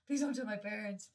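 loud, desperate pleading; retold tearful begging. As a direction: mimic a friend bawling for mercy. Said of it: "Kate started bawling, crying".

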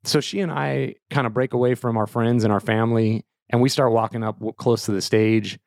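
plain reading voice

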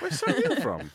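high, loud question through a laugh. Real, not acted. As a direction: ask it while laughing.

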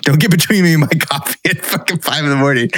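mock warning. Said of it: "So mad".

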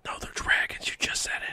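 hushed insistence; whispering. Acted. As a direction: whisper it back stubbornly. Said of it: "why are you whispering".

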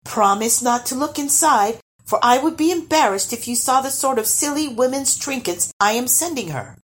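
put-on high, sweet girlish coaxing; sweet, high-voiced request; sweetly. A narrator's reading. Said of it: "she added sweetly".

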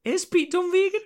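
high, loud disbelieving squeak; shocked he might be vegan; incredulous. Real, not acted. Asking co-host in high loud disbelief. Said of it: "I think he is".

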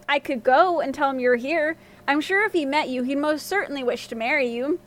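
plain reading voice

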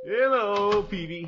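big booming hello, pitched high; hearty greeting. Acted. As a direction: call out cheerfully as you walk in. Gildersleeve calls out cheerily.

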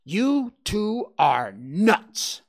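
high, loud exasperated outburst; fed up. Acted. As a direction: snap with no patience left. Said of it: "had finally had enough".

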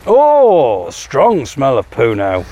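usual speaking voice

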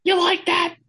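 very high, far-off mocking heel voice; mock MJF voice. Cohost voicing MJF about his pickle jar, smug mock heel.

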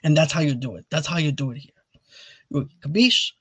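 put-on playful Italian-American accent; Robert De Niro. Host finishing his catwalk lesson, in an Italian-American impression. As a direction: wrap up the lesson like an Italian-American tough talker. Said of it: "If you wanna do an Italian impression".

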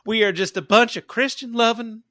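high, loud hammed-up Southern accent; exaggerated American Southern accent. Making fun of Americans, loud Southern accent. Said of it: "do the Southern accent".

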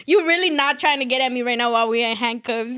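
loud, amused brush-off; mock dismissive retort. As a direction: tell off a fool, half-joking. Mock retort to fellow detainee.

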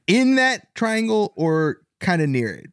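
ordinary delivery

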